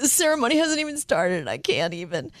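choked-up dismay. Retold or imitated. At the wedding before it starts, choking up. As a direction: hold back tears that are welling up. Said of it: "I just like".

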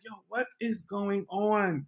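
soft, slow, drawn-out disbelief; hushed retold bewilderment; bewildered aside. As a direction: say it under the noise, slowly, totally baffled. Bewildered aside amid the quarrel.

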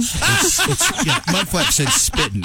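usual speaking voice

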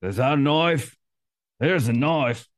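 hammed-up Aussie twang; butchered Australian accent; mimicked Australian accent. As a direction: imitate an Australian accent, badly. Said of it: "try and mimic you and say".

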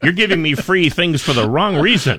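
lightly voiced dad protesting too much; mock honest dad. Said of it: "my dad would explain that to him".

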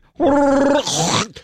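high, loud growling creature cry; Wookiee roar. Acted. Radio host imagining Chewbacca at the movie's end, growling roar. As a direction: imitate a long Wookiee growl. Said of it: "he was like".

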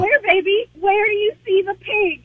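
mildly sing-song mommy voice; sweet mom voice. Retold or imitated. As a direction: gently humor the child. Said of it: "You're like".

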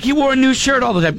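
mock wife, high and fast. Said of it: "she's like".

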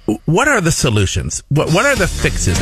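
normal talking voice

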